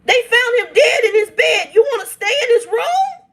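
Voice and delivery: high, tearful, breaking voice; crying in outrage. Real, not acted. Telling viewers the cousin wanted her dead father's room, crying. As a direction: cry out the disbelief.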